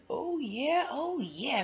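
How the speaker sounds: high, eager "oh yeah" twice; excited yes. Acted. Jumper eagerly agreeing to bike with Sophie, high excited voice. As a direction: jump at the idea.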